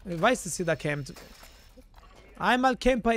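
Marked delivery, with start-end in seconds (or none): 2.4-3.1 singsong jeer, pitched up and louder; mocking taunt. Real, not acted. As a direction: call it out like a schoolyard taunt. Taunting enemy campers he hunts.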